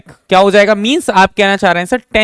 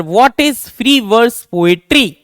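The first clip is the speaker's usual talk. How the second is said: slow dictation; 3 semitones higher, much slower than usual. Shown as a demonstration. Teacher dictating definition.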